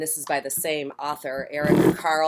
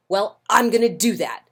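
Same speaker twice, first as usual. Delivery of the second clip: loud, higher huffy boast; grouchy ladybug; 6 semitones higher, 8 dB louder than usual. Acted. Grouchy ladybug vowing to fight someone bigger, loud and huffy. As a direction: boast it defiantly.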